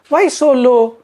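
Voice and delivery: high, loud sneering demand; scornful boss. Retold or imitated. Komudi dismissing Anand's target, high and loud. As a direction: snap it at him like a contemptuous manager. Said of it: "he says like".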